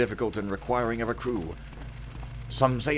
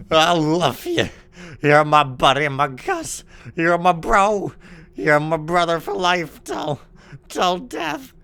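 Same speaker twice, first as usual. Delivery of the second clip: loud, gushing mock devotion; hammed-up affection; 7 semitones higher, 11 dB louder than usual. Streamer declaring love to his buddy Gus, loud and hammed-up. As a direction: declare undying friendship at high volume.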